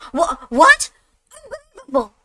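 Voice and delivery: high, strained cry of shock; stunned disbelief. Acted. Officer can't believe the permission, high and shocked. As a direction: squeak out your outrage.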